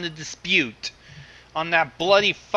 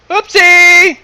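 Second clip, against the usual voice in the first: much higher, louder squeaky oops; cutesy oopsie; 14 semitones higher, 16 dB louder than usual. Real, not acted. Host fumbling his screen, squeaky cutesy oops. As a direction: squeal it like a cartoon.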